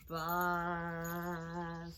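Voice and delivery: "bus" with long vowel, long final hiss; said slowly. Shown as a demonstration. Teacher counting sounds for children, drawn-out. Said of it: "Let's say it slowly".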